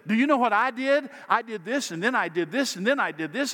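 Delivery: mock the people who boast about their deeds; mock braggart. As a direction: imitate a self-important bragger. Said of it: "People who say".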